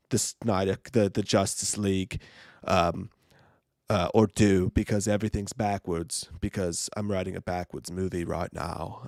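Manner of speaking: lightly British mock filmmaker voice; Christopher Nolan impression. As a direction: play the pompous British director. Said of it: "what Christopher Nolan fucking sounds like".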